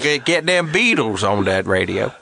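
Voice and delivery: Cajun radio talker; Lafayette radio guy. Retold or imitated. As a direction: imitate a Louisiana Cajun radio host. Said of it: "one Lafayette radio guy".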